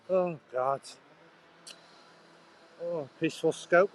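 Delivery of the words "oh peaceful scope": higher, mock-wondering cry of irony; ironic exclamation. Real, not acted. Vlogger on a noisy road, ironic higher exclamation. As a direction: exclaim it, dripping with irony at the noise.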